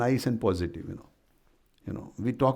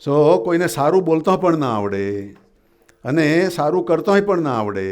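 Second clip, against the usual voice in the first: raised, loud, fast preaching; emphatic preaching; 6 semitones higher, 7 dB louder, much faster than usual. Real, not acted. Preacher lamenting to the congregation that we can't speak kindly, emphatic preaching. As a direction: drive each phrase out high and loud.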